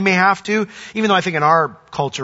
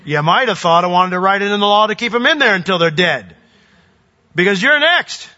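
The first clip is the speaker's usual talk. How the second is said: raised voice, climbing high on the threat; mock royal threat, building higher and louder; imagined vengeful king; 4 semitones higher, 4 dB louder than usual. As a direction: play the king turning on his accusers. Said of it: "looking over at them and goes".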